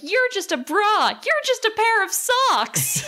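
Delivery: high, slow mock insult. Fighters trading silly insults, high mock taunt. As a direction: taunt like a cartoon brawler. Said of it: "being like".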